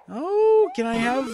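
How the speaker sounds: usual speaking voice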